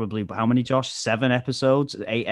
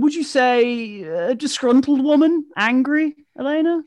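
very high, louder posh English; posher voice; 13 semitones higher, 5 dB louder than usual. Shown as a demonstration. Host showing range while questioning guest, posh English voice. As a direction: put on a posh English voice, pitched right up. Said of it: "I can actually talk a little bit posher".

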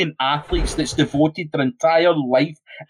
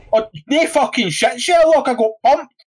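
sarcastic retort; 7 semitones higher than usual. Retold or imitated. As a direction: throw the sarcasm back hard. Sarcastic reply to journalist.

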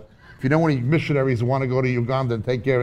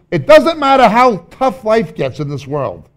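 emphatic preaching; 8 semitones higher, 9 dB louder than usual. Real, not acted. Preacher building up contrast.

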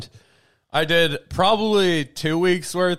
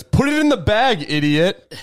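snapping; 6 semitones higher, 4 dB louder than usual. Retold or imitated. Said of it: "Snapped at him".

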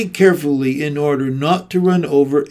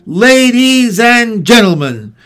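higher, louder, slow ladies and gentlemen; grand slow opening; 7 semitones higher, 7 dB louder than usual. A narrator's reading. Announcer opens the ceremony to the crowd, slow and grand. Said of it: "said the loudspeaker".

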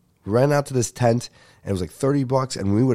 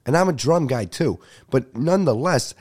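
ordinary talk, nothing marked

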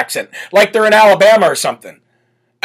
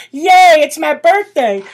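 mock Kamala cheer; 8 semitones higher, slower than usual. Mimicking Kamala.

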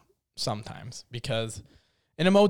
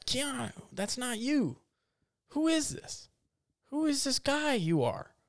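much higher, pleading at the actor; exasperated disbelief; 9 semitones higher, 6 dB quieter than usual. Real, not acted. Scolding the actor in high exasperated pitch.

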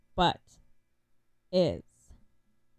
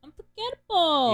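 loud, high, dramatic "Unforgettable"; gushing exclamation; 10 semitones higher, 16 dB louder than usual. Real, not acted. Fan raving about a beloved musical's score, loud gushing exclamation.

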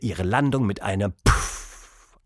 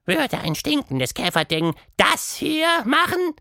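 high showman call; monster calling out. A narrator's reading. Quatschmonster to the mouse, high showman call. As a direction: point grandly at her. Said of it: "rief Quatschmonster".

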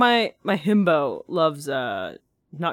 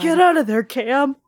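frightened high shout at the screen; scared yell; 7 semitones higher, 7 dB louder than usual. Real, not acted. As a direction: yell a warning in alarm. Scared yelling at film character.

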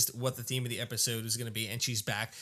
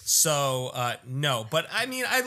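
ordinary talk

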